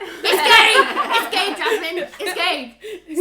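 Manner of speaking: high, loud yelling through laughter; furious flatmate yelling at Jasmine; exasperated shout. Retold or imitated. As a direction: shout it like you've been woken up. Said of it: "I was like".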